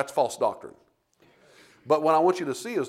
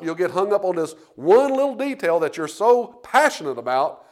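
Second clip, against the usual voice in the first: loud, high, driving sermon voice; preaching at full force; 5 semitones higher, 7 dB louder than usual. Acted. Pastor warning his church against soapbox doctrines, full-force preaching. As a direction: hammer it home from the pulpit.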